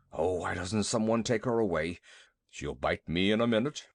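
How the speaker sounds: low, fretful elephant voice, pleading then scolding; a big beast scared of a small dog, rumbling low; nervous elephant. Acted. Narrator voices frightened elephant.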